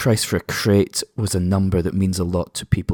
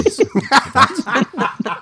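laughing. Real, not acted. Awkward joke about Nazis topic.

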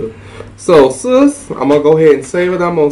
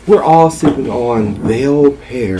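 ordinary talk

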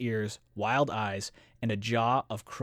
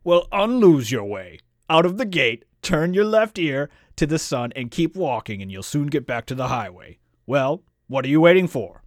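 loud, raised monster ordering him out; gruff dismissal; 3 semitones higher, 8 dB louder than usual. A narrator's reading. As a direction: send the intruder packing in a big voice.

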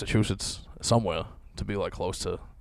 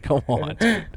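incredulous; 7 semitones higher, 5 dB louder, slower than usual. Retold or imitated. Incredulous retort in retold chat.

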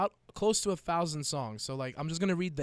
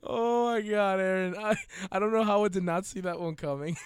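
laughing; 7 semitones higher, 6 dB louder than usual. Real, not acted. Cracking up at the joke.

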